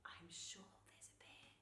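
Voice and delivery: soft, secretive whisper; hushed storybook whisper. A narrator's reading. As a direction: whisper a careful warning. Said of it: "she whispered".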